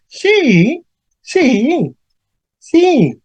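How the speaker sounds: high, emphatic sí repeated. Shown as a demonstration. Teacher contradicting a surprised question with emphatic sí. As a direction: insist sí from high up. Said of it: "Yo estoy sorprendido".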